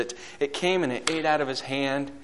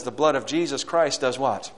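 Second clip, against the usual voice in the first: ordinary delivery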